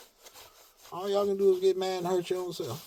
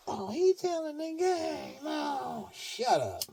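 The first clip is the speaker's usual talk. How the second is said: mocking the enemies; 11 semitones higher, much slower than usual. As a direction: mimic scared schemers getting exposed. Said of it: "this is what they're afraid of".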